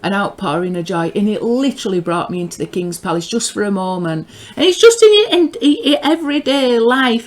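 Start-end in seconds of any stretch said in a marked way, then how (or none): 4.6-7.3 high, loud, laughter in the voice; amused laughing. Real, not acted. Marvelling that it happens in everyday life, amused laughing. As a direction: laugh it out, pitch jumping up.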